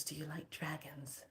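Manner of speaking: hushed unvoiced whisper. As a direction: lean in and whisper it. Boss whispers secretively.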